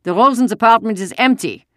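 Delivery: put-on second soldier, German-accented and projected. A narrator's reading. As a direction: report it forcefully as a German officer. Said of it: "Another German voice said".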